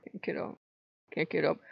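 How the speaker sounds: very soft, faint weary protest; acted exhaustion. Answering the god urging her up, feebly exhausted. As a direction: barely get the words out, worn out.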